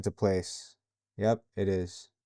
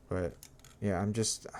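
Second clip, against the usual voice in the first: ordinary talk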